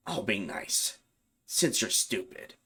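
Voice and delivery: soft, slow, sneering possessed voice; mocking demon. Demon holding a knife to his heart, in a soft sneering voice.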